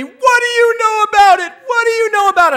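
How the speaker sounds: high, loud, accusing scream. Retold or imitated. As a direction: imitate a woman screaming in outrage. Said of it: "she's screaming at me".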